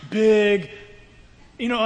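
ordinary delivery

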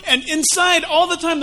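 normal talking voice

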